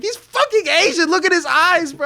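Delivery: high, loud incredulous outburst; acted exasperation. Child answering baffled relatives, acted exasperated yelling. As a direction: yell it as if it's blindingly obvious.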